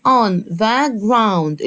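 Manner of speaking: on the ground drawn out very slowly, aw in ground clear; slow modelled phrase. Shown as a demonstration. Teacher modelling on the ground slowly for children. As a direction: say on the ground slowly for kids to repeat. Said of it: "ال O وال U أنطقها آو".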